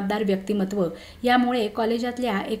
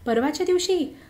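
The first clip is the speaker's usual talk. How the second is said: mildly startled, higher-pitched question; 6 semitones higher than usual. A narrator's reading. Friend questions the reported sighting, startled. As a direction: echo it back in surprise. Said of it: "मोहननं चमकून विचारलं".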